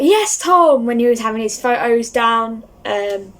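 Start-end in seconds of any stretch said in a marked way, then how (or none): 0.0-0.9 high, loud cheer; shouted out. Retold or imitated. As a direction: cheer his name across the room. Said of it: "I also shouted out".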